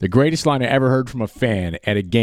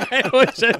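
laughing; 12 semitones higher than usual. Real, not acted. Laughing after the heckle story.

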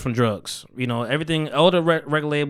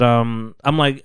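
ordinary delivery

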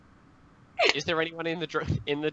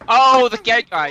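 excited; 6 semitones higher, 12 dB louder, slower than usual. Real, not acted. Excited drunk exclamation.

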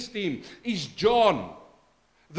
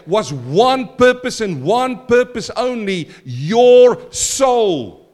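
preaching builds louder to a shout; building sermon; 3 semitones higher, 6 dB louder than usual. Acted. Preacher to congregation, rising to a shout. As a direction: climb to the last words.

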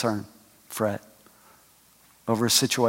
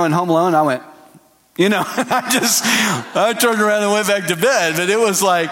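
usual speaking voice

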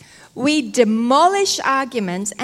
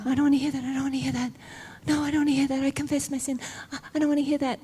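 soft, slightly raised mock pleading; fretful refusal; 3 semitones higher, 6 dB quieter, much faster than usual. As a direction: act out shutting out a nagging voice. Acts out refusing the enemy's accusations.

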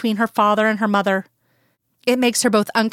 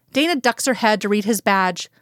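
plain reading voice

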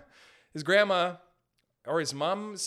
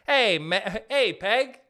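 calling out; 3 semitones higher, 6 dB louder, slower than usual. Pretend. Calling out to Meghan.

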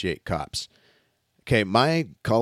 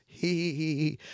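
high, breathy mock giggle like a little girl; teenage girl giggle; 6 semitones higher, 6 dB quieter than usual. Friends mimic giggling like teenage girls after escaping a ticket, mock high giggle. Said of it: "laughed like little teenage girls".

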